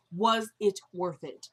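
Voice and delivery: slow "was it worth it", each word set apart; measured, word-by-word "was ... it ... worth it"; separating every word. Retold or imitated. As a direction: land on WAS, IT and WORTH IT one by one. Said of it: "he separates every".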